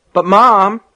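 put-on high, loud child's protest; insistent young boy objecting. A narrator's reading. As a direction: plead back at Mom. Boy protests to his mother.

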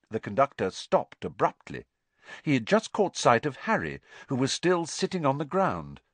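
usual speaking voice

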